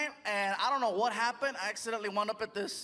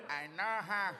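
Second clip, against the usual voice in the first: low-key celebrity impression, softer; George Lopez; 4 semitones lower, 6 dB quieter than usual. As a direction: lightly imitate a comedian's soft catchphrase. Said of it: "George knows I do that".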